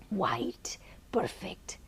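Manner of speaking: soft, hushed whisper of wonder; awed whisper. Real, not acted. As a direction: breathe the words out in awe. Awed recollection of the meditation.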